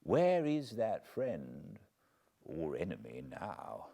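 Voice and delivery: plain reading voice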